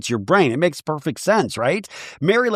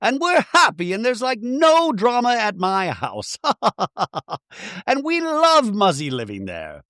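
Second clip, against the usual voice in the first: much higher, gushing Bronwyn voice with a giggle; high Bronwyn impression; 8 semitones higher than usual. Mocking Bronwyn protesting she's happy to Heather, pitched way up. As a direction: squeal your way through denying any drama. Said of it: "And Bronwyn's like".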